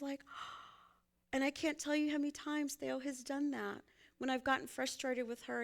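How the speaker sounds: normal talking voice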